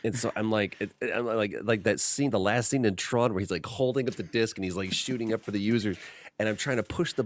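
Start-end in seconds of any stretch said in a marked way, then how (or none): none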